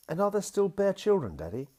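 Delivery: put-on high, eager child asking; a small girl's bright question; little girl voice. A narrator's reading. Daughter asks her daddy in a high, eager little voice.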